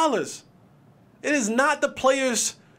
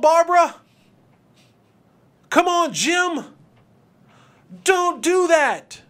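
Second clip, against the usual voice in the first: higher, louder nagging coworker; mocking coworker; 6 semitones higher, 4 dB louder, slower than usual. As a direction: whine like a meddling coworker. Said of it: "one of your coworkers was like".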